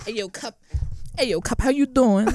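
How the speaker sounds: high-pitched, hammed-up Baltimore accent; mock Baltimore accent. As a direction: call out in a goofy Baltimore accent. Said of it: "like, a Baltimore accent".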